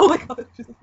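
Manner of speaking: loud shocked mock exclaim; mock outburst. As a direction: yell it like a shocked relative. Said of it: "and you go".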